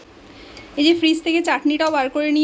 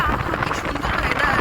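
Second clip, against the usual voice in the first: mock delight. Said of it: "ভিডিওতে দেখে মনে হবে".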